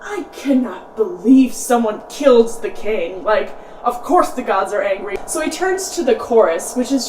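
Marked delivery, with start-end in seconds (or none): 0.0-5.2 mock angry outburst. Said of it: "Oedipus gets really angry about this".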